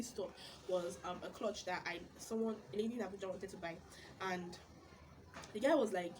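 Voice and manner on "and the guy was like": ordinary talk, nothing marked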